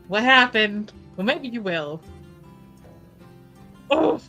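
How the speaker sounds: ordinary talk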